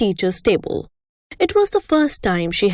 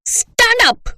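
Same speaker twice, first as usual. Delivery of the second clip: much higher, louder command; angry order; 13 semitones higher, 4 dB louder than usual. Acted. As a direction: bark the order. Headmistress ordering pupil.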